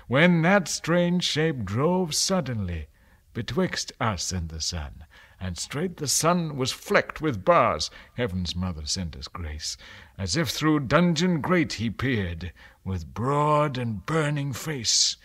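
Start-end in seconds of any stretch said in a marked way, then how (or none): none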